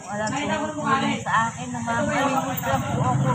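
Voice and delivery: high, loud, begging plea to donors; pleading appeal. Real, not acted. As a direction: cry out for help, raising the voice. Beggar pleads to online donors. (malakas at mataas na pagmamakaawa)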